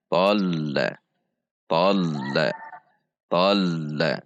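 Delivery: tol-la with the L held midway, neither jerked nor dragged; moderate hold. Shown as a demonstration. Teacher models tol-la for students, L held moderately. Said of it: "namun di tengah-tengah".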